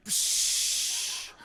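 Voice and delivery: long unvoiced hiss of branding; searing sizzle sound. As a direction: imitate hot iron searing in. Imitates heat searing his brain.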